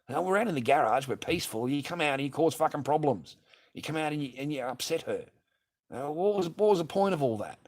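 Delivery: high, loud angry telling-off; angry yell. Retold or imitated. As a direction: tell her off, furious. Retelling yelling at ex.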